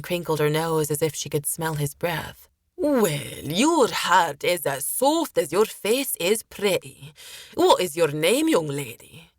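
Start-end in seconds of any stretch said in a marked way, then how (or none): 2.8-9.3 sailor's slow, swooping flattery; leering sailor character. Acted. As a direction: play a sailor oiling up to a young lady.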